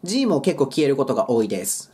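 usual speaking voice

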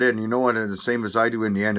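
ordinary delivery

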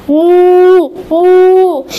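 loud, long imitated moo; happy cow moo. Cow happy to have her voice back, mooing. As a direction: moo out loud, holding the vowel. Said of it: "Daisy the cow was happy".

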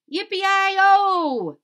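high, loud drawn-out holler; cowboy whoop. Acted. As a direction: let out a rousing cowboy yell. Cowboy's cry in the story.

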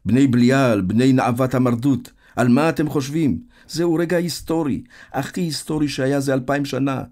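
loud, high-pitched harangue; shouting. A narrator's reading. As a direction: shout it like a rousing scolding. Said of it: "ובני מרשק צועק".

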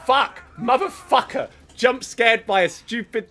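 loud high startled cursing; panicking gamer. Real, not acted. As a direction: curse out loud after a jump scare. Jump scared while playing.

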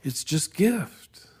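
slow, breathy awe at grace; a reverent whispered aside; hushed whisper. Real, not acted. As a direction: let it fall to a slow whisper. Awed point about grace.

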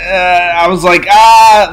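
a panicked high yelp, loud and sudden; retold panicked yelp. Student teacher panicking after cursing in class, high yelp. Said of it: "I started freaking out".

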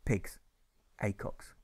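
soft whispered mutter. Acted. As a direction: mutter it to yourself. Muttering continues.

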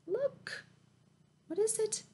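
high, soft, curious; lightly voiced mama. A narrator's reading. Mama pointing out the snail to Mouse, lightly voiced. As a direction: ask it like a gentle mother. Mama's lines voiced higher.

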